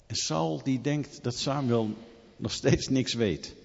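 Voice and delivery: usual speaking voice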